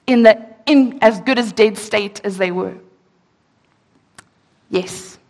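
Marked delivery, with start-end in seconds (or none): none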